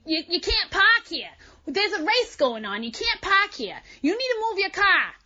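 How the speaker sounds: mock police officer. Police officer telling her to move her car, mock bossy voice.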